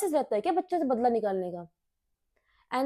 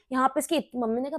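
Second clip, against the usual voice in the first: normal talking voice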